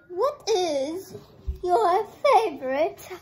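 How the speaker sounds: loud, high, emphatic question; big booming interviewer question. Real, not acted. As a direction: belt it out in separate chunks.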